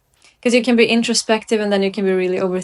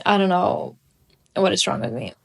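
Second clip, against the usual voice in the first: usual speaking voice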